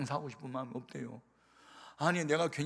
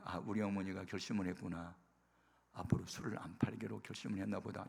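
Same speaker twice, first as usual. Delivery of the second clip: whispered, lower private thought; inner whisper; 5 semitones lower, 7 dB quieter than usual. Retold or imitated. Son realising in secret that mother quit selling liquor, whispered. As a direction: murmur the realisation to yourself.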